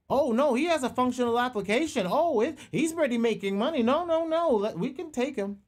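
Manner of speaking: high, eager boss voice; excited hiring manager. Retold or imitated. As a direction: gush like a hiring boss won over. Voicing hiring managers reacting.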